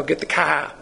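put-on New York accent, dropped R. Teacher imitating an out-of-towner, New York accent. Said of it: "somebody from New York".

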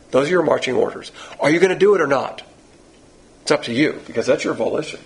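ordinary delivery

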